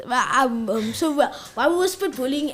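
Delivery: usual speaking voice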